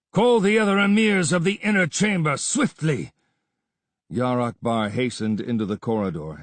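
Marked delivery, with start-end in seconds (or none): 0.1-3.1 higher and louder urgent order; commanding shout. Acted. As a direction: snap an order at the servant. Vizier orders emirs summoned.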